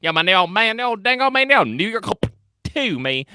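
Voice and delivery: hammed-up country accent, words running together; thick country-accent gibberish. Playing the stranger from New York, nonsense country accent.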